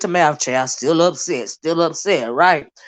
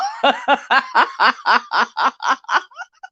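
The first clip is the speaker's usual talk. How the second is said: helpless, long run of higher laughter; long belly laugh; 5 semitones higher than usual. Real, not acted. Vlogger mocking an upset rival, long laughter. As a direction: laugh on and on, real and unrestrained. Cracks up mocking the man's anger.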